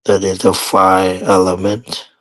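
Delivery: ordinary talk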